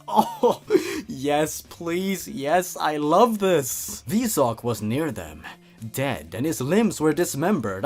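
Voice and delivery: normal talking voice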